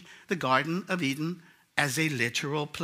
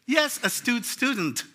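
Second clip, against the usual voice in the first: lofty professor; 6 semitones higher, slower than usual. Acted. Professor voiced in classroom skit.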